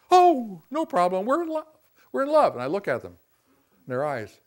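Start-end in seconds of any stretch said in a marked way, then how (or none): 0.1-2.5 much higher, louder lovestruck gush; giddy lovers. Retold or imitated. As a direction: brush off the question like starry-eyed newlyweds. Infatuated couple answering counselor.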